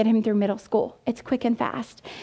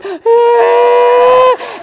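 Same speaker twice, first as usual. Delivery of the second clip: high, sustained imitated bawl; hammed-up sobbing howl; loud mock crying wail; 12 semitones higher, 12 dB louder than usual. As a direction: wail like an overgrown crybaby. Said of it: "Oh my gosh, his wedding night".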